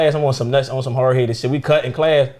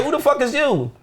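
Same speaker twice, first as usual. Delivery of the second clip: higher, sharp mock mom challenge; protective mom; 9 semitones higher than usual. Older mom confronts stranger holding her daughter, higher challenge. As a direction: play the suspicious mom.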